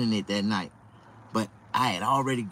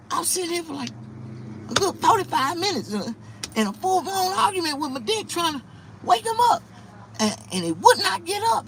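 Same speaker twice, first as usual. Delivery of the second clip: exasperated high narration. Retold or imitated. Recounting the argument, voice pitched up.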